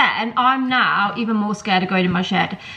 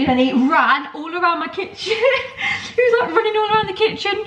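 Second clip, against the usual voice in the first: high-pitched, laughing retelling; cracking up mid-story; 8 semitones higher than usual. Real, not acted. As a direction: struggle to speak through giggles. Laughs retelling his panicked run.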